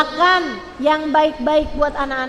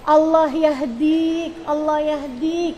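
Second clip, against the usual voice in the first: slow, drawn-out invocation; long held syllables, imitating a patient parent; imitating restrained anger; much slower than usual. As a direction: stretch each word as a parent's restrained prayer. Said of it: "cuman ngomongnya kayak gini".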